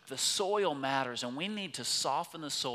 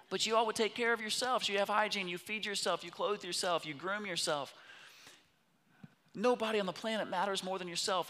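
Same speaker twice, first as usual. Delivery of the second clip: higher and louder, fast, preaching hard; preaching at full force; 8 semitones higher, 3 dB louder, faster than usual. Acted. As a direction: drive it home to the congregation with rising fervour. Pastor preaching to the congregation.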